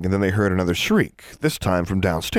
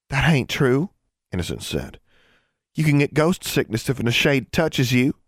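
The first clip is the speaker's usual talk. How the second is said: a hint of a stubborn country girl; lightly voiced Ozark girl; 6 semitones higher than usual. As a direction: insist like a stubborn little girl. Innocence voiced high.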